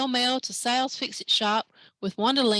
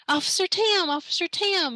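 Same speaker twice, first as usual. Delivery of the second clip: higher repeated call after a policeman; yelled; 8 semitones higher than usual. Acted. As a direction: yell after him to stop him. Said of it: "yelled Tiffany Ann".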